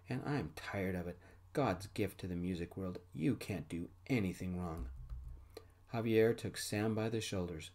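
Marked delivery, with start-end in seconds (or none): none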